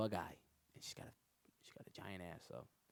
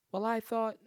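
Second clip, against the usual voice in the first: mock girl voice; 11 dB louder than usual. Girl quoted.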